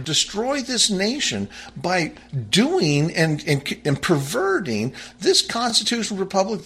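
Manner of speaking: high, urgent cry of warning; impassioned, high-pitched denunciation; voice in the wilderness. Real, not acted. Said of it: "the voice in the wilderness".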